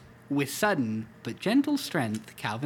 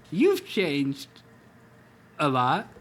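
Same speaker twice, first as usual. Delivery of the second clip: sadly; 4 dB louder, slower than usual. Acted. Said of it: "Hobbs said sadly".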